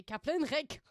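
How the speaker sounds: loud mock German accent; forceful, exaggerated German pronunciation. Shown as a demonstration. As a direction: bark it in a put-on German accent. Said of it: "J'allais dire l'accent allemand".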